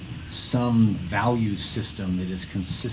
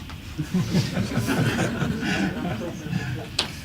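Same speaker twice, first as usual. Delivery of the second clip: breathy laugh; 9 semitones higher than usual. Real, not acted. Laughs after robot overlords joke.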